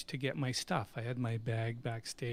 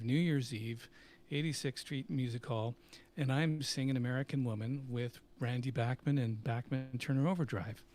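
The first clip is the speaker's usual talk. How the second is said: usual speaking voice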